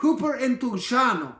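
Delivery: Greek word with an Italian lilt; Italian accent. Real, not acted. Host struggling to say a Greek word, Italian accent. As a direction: attempt the Greek, sounding Italian. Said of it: "That sounded Italian".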